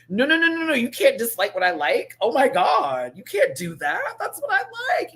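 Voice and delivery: high, loud squealing protest; mock outrage rising to a shout. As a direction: squeal it like a spoiled kid. Acting out a Libra protest.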